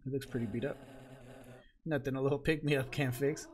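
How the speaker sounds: usual speaking voice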